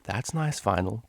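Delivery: ordinary delivery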